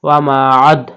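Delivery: loud "আদ" with a bouncing d after a held "মা"; forceful recited "আদ" with an echoed final d; qalqala. Shown as a demonstration. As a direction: stretch "মা", then pop the d of "আদ". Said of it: "কলকলা করে পড়তে হবে".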